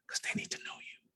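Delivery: near-whisper, very soft; confiding whispered aside; hushed emphasis. Real, not acted. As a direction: drop right down to a whisper to make the point.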